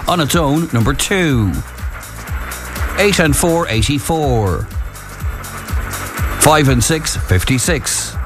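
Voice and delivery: slow calling of bingo numbers; bingo caller. Acted. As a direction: call each number out with pauses.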